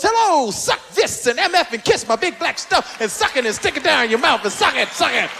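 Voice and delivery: raunchy stand-up impression, fast; crude comic impression. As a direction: rattle off the filth, fast and crude. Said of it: "he walks out and he goes".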